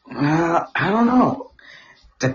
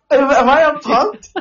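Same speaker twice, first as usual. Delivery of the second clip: bewildered prank victim; 13 semitones higher, slower than usual. Retold or imitated.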